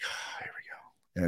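slow whispered here we go; resigned hunter's whisper. Retold or imitated. Deer blows out, resigned.

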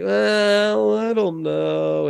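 drawn-out, reluctant "well, I don't know"; hesitant, stretched-out words; retold wavering. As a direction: mimic a kid slowly giving in. Retold kid wavering under pressure.

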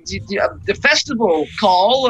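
tiny mayor voice, pitched high; high-pitched character voice. Acted. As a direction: puff up like a little official. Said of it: "It's gonna go".

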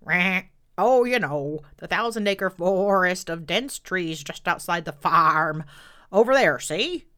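put-on loud bleating old sheep. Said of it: "old sheep".

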